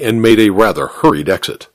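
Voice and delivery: ordinary delivery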